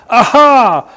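high, loud, drawn-out gloating exclamation; gleeful cheer. Acted. Preacher reading the Ammonites' rejoicing over Israel, gleeful cheer. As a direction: crow it like a victory cheer. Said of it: "just a good old-fashioned yay".

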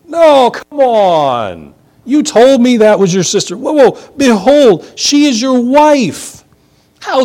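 high, loud outburst; scandalized king crying out; shocked disbelief. Acted. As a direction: stretch out the No in disbelief. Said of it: "Abimelech is like".

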